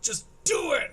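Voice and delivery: "just do it" with "do" punched loud and falling; firm commanding "just do it"; order. Shown as a demonstration. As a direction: give "just do it" as a curt order. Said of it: "like it's an order coming from someone".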